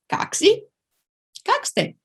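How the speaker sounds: "Как си? Как сте?" asked high, bright question tune; high clear question demo. Shown as a demonstration. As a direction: model the questions for students. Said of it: "It's for a single person".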